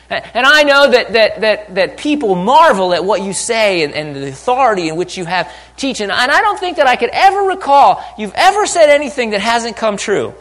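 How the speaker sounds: high, loud, hammed-up concession; protesting Peter. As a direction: build an emphatic case at full voice. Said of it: "It's kinda like he says".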